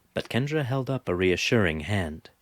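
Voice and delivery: ordinary delivery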